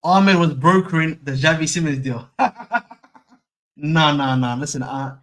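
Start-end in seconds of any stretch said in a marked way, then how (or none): 2.3-2.9 short burst of high laughter. Real, not acted. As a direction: crack up at your own joke. Laughs at his own joke.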